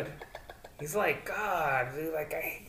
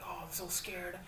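whispered, fake frightened; mock him in a scared hush; 3 semitones higher, 9 dB quieter, slower than usual. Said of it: "he always talk about".

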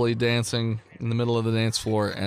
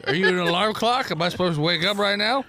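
put-on higher, slurred drunk teasing; drunk townsperson; 6 semitones higher than usual. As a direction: needle them like a rambling barfly. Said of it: "This is my drunk voice".

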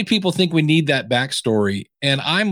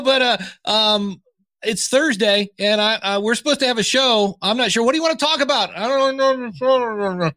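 clueless host; 7 semitones higher than usual. Retold or imitated. Mocking a clueless podcast host.

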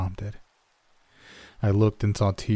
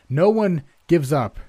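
loud, higher, worn-out call; rally the stragglers though exhausted; tired shout; 8 semitones higher, 6 dB louder than usual. Acted. Narrator shouts back at straggling children, tired and loud. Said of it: "I shouted tiredly".

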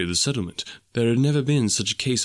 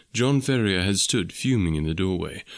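ordinary delivery, nothing marked

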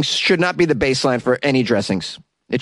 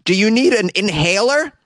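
higher, lightly voiced fake worry; mock concern to a dog; 8 semitones higher than usual. Host imitating himself fretting over a wheezing French bulldog, higher mock concern. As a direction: talk to the wheezing puppy with mock alarm. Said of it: "I'm like".

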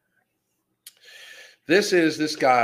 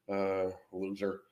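dismissive; 5 semitones lower, 10 dB quieter, slower than usual. Real, not acted. Dismissive insult after rant.

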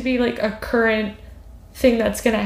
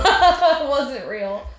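laughing; 4 semitones higher, much slower than usual. Real, not acted. Laughing off the tear.

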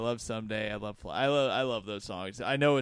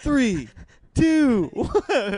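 rising, louder mock countdown; 11 semitones higher, 8 dB louder, much slower than usual. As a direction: build it up, number by number.

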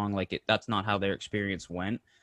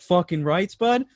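pumped up; 7 semitones higher, 4 dB louder, slower than usual. Retold or imitated. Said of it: "slapped Parker Kane on the shoulder, like".

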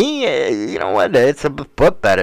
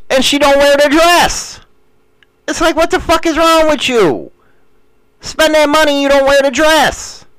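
much higher, exasperated griping; exasperated rant; 10 semitones higher than usual. Retold or imitated. Husband griping to viewers about his wife, exasperated. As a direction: vent it like a fed-up husband.

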